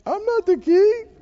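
mock crying. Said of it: "Are you gonna cry and say".

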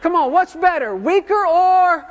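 loud, high call for a response; big call-and-response shout; rallying the crowd. Real, not acted. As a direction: prompt the congregation, stretching the last words. Rallying congregation to shout answer.

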